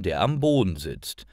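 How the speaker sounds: ordinary delivery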